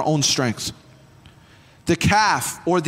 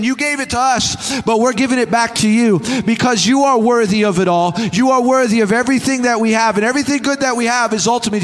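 fervent preaching; 4 semitones higher, 5 dB louder than usual. Acted. Elders' declaration, preached at full force.

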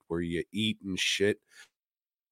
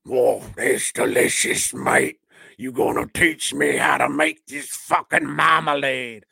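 Paddington voice; 3 semitones higher, 5 dB louder than usual. Acted. Paddington voiced in comedy retelling.

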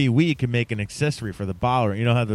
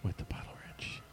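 hushed mystical whisper; 20 dB quieter than usual. Pretend. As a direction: breathe it like a secret.

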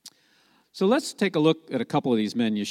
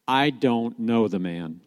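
slow, deliberate denial at usual pitch; sworn denial; slower than usual. Acted. Disciple swearing a last time to the crowd, slow and deliberate. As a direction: space the words out, level and firm. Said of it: "began to call down curses and swore".

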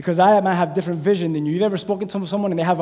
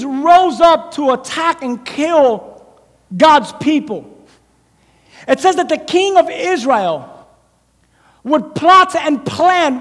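louder, higher preaching voice, slowed on key words; full-force preaching; 7 semitones higher, 6 dB louder than usual. Acted. Preacher driving the Elisha story home to the congregation, full force. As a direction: preach it out to the whole room.

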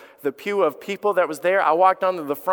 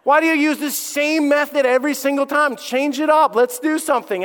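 higher, exasperated lecturing; frustrated rant; 7 semitones higher than usual. Retold or imitated. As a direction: scold the scammer in mock frustration. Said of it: "I was just so frustrated".

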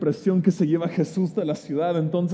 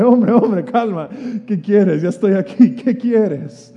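amused, loud and soothing; calm him with a chuckle; laughing gently; 3 semitones higher, 8 dB louder, slower than usual. Retold or imitated. Jesus laughing as he calms the beggar. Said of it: "Jesús hasta con una risa".

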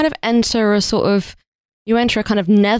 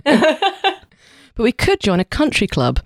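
slow deadpan; 6 semitones higher, much slower than usual. Pretend. Playing half-scissors character.

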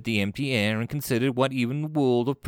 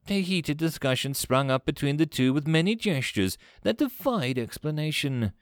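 plain reading voice

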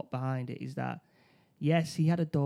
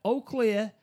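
higher, louder mock police call; mock shout; 7 semitones higher, 6 dB louder than usual. Retelling the police officer's call to a friend, a mock shout. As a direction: call it out like an officer clearing a room. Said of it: "you hear the shout of".

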